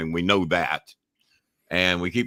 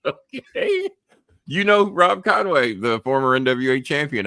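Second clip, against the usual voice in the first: high, loud incredulous reply; amazed disbelief; 9 semitones higher, 5 dB louder than usual. Retold or imitated. Host retelling the Instacart call, high amazed disbelief. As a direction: marvel at the caller's wrestling trivia.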